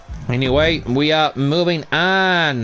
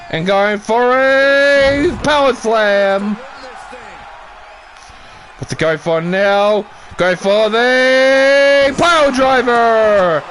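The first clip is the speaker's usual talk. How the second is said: excited play-by-play; 6 semitones higher than usual. Acted. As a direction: sell every near-fall to the crowd.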